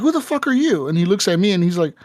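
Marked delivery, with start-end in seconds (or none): 0.0-0.9 high-pitched retold outrage; mock indignant challenge. Bandit spots the player, mock indignant challenge.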